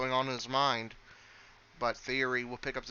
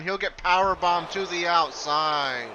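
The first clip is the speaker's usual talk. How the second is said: louder, stretched-out hype on the big move; excited call; 5 semitones higher, 10 dB louder, slower than usual. Acted. Commentator hyping a power bomb for viewers, loud and excited. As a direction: sell the power bomb like it's huge.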